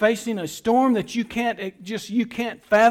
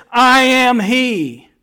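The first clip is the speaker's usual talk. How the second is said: louder, very slow declaration; Jesus proclaiming; 4 semitones higher, 8 dB louder, much slower than usual. Acted. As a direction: stand firm before the soldiers, slowly. Jesus answers arresting soldiers.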